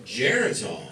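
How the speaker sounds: ordinary talk, nothing marked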